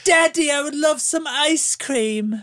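very high, slow child's mock-British accent; daughter doing British. Host imitating daughter asking Daddy for treats, high British voice. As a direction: impersonate a little girl playing British. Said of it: "I asked her to do British".

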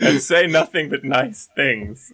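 high, slow playful plea; drawn-out mock pleading; mock begging. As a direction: beg jokingly for kind words. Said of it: "we begged them to say".

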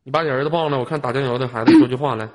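ordinary delivery

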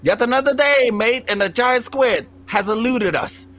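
hammed-up Australian presenter accent. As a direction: imitate an Australian TV explorer. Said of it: "his stupid Australian accent goes".

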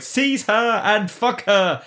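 high, very slow imperious command; mock regal command. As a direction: decree it like a haughty queen. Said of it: "the authority in the voice of".

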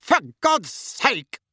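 high, slow, exasperated roar. A narrator's reading. Uncle reacts to yet another owl, roaring. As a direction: roar it out with each word stretched. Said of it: "roared Uncle Vernon".

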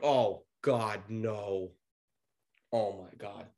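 low, sinking groan of retold dread; hushed, deflated mock despair; quiet dread. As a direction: let it drop soft and low. Dreading the table seating, retold.

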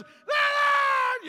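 sudden high "Ah!" shouted out; a comic burst of a yell; mock yell. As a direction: blurt a loud startled cry. Retelling people shouting louder.

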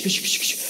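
soft, voiceless shushing noise; imitated rattle. Shown as a demonstration. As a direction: mimic shaken branches sounding like rain. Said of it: "just a little bit of a shh".

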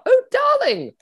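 over-the-top posh lady; Camilla voice. Acted. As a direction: coo it up high like a posh lover.

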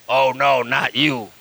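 low-pitched imitation of a devil; demon voice. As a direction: voice the demon in a possessed woman, dropping low. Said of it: "Just like that".

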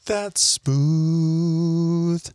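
raspy Crypt Keeper croon; smooth whiskey ad voice. Acted. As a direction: purr it like a whiskey commercial. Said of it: "What was that?".

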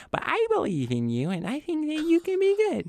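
very high, sincere sweet squeak; earnest, tiny-voiced encouragement; Rolda. Shown as a demonstration. Kobold cleric encouraging a distrusted rogue, sincere high voice. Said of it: "be sincere in that voice".

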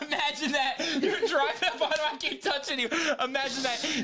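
high, giggling words pushed out between laughs; can barely get it out for laughing; laughing through it. Real, not acted. Telling Doug about the truck while laughing hard. Cracking up retelling car story.

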